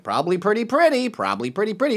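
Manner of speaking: lightly mimicked woman from a comedy sketch; imitating sketch woman. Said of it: "and she's like".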